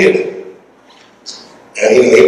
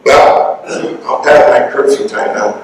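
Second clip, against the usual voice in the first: normal talking voice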